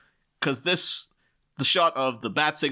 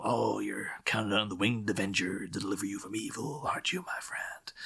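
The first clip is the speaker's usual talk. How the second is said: Tommy Lee Jones line; 2 semitones lower, 5 dB quieter than usual. Retold or imitated. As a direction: quote Two-Face quietly, like a threat. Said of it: "does this, you know".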